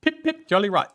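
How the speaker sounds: hammed-up British catchphrase, said high; posh British accent. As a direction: play the stiff British toff for a laugh. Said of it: "Oh, I'll do that".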